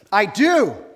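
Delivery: loud, high, spat-out retort; a furious yes flung upward; defiant outburst. Acted. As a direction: snap back at God like a cursing prophet. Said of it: "almost a cuss word right there".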